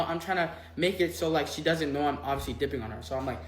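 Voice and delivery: normal talking voice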